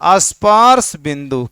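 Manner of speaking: "स्पर्श बिंदु" drawn out very slowly; slow answer. Shown as a demonstration. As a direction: spell the answer out for the class. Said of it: "क्या कहते हैं जी?". (धीमे-धीमे खींचकर "स्पर्श बिंदु")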